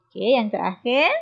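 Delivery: usual speaking voice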